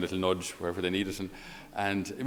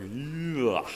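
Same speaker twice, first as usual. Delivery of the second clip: a groan of distaste; a short grimacing ugh; disgusted ugh; 3 semitones higher, 3 dB quieter than usual. Real, not acted. Preacher reacting to hearing the gospel words, disgusted ugh.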